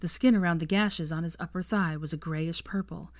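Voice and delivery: plain reading voice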